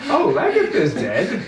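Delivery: high, slow, offhand mock voice; mock dismissive king. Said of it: "he's just gonna be like".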